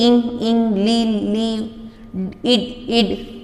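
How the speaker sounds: इंग, ली and इड each said twice to show the shared ending; teacher repeating rhyme endings इंग, ली, इड; rhyme sounds. Shown as a demonstration. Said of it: "the sound should be same".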